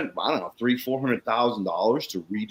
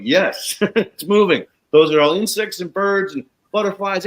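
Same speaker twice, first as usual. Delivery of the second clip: louder, higher, laughing delight; excited; 7 semitones higher, 6 dB louder than usual. Real, not acted. Telling Jeannie her lawn is alive, laughing excitement. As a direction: burst out with the good news.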